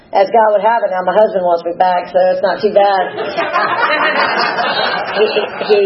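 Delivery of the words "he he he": short rueful laugh, a bit high; ironic laugh. Real, not acted. Sharing her ex-husband's return, a rueful laugh. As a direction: laugh it off at the irony.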